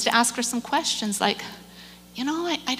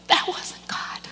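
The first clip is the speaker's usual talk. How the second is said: choked up. Real, not acted. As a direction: voice gone to a whisper. Moved after upsetting story, choked up.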